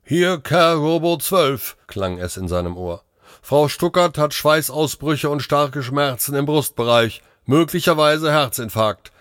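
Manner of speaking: high-pitched automated radio announcement; robot dispatcher. Acted. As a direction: report it like a machine operator. Robot dispatcher reports over radio.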